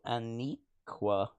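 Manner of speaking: ordinary talk, nothing marked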